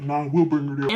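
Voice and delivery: a hint of a low father voice; lightly voiced dad. Dad turning down the ambulance, lightly imitated, low.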